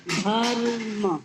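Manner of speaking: धर्म drawn out slowly; slow blended word. Shown as a demonstration. Teacher rejoining split letters, slowly drawn out. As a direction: stretch धर्म into one slow sound. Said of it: "विग्रह अगर आप करेंगे".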